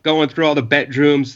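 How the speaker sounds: ordinary talk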